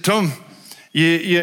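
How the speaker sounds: light Zimbabwean accent, imitating a friend; a touch of Zimbabwean lilt. Pastor imitating his Zimbabwean friend, light Zimbabwean accent. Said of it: "I'm trying his accent".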